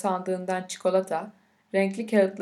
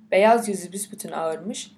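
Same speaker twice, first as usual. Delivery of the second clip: plain reading voice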